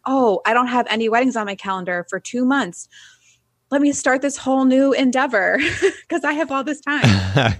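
high-pitched, breaking into laughter; mock-eager self-talk. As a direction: poke fun at your own overeager planning, voice climbing. Mocks own eager self-talk.

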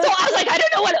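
high and loud, half laughing; laughing panic. Retold or imitated. Retelling her inner worry to the interviewer, laughing. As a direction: spill out comic worry.

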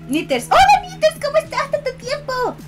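high, loud cheerful hello; excited greeting. Real, not acted.

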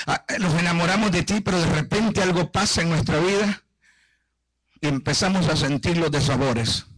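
low, earnest pastoral prayer. Real, not acted. Leads the congregation in prayer.

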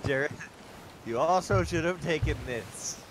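usual speaking voice

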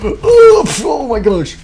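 very high, loud play scream; mock scream of pain. Player hit by the rabbit, high mock scream. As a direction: shriek as if hit in a game.

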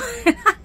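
short laugh. Real, not acted. Laughing at her own remark.

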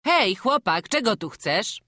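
put-on high, loud call to a stranger boy; the farmer calls to the boy at the door, loud and high. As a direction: hail the child from the doorway.

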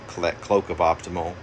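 ordinary talk

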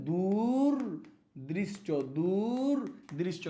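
দূরদৃষ্ট stretched very slowly, rising then falling; slow dictation. Shown as a demonstration. Teacher dictating the right spelling, slowly. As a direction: draw out দূরদৃষ্ট for the class. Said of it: "তাহলে কি হবে?".

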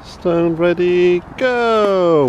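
slow build, then a loud high go; ready, set, go, then a loud high cheer; excited cheer. Real, not acted. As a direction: count him in, then cheer the throw. Cheering toddler's stone throw.

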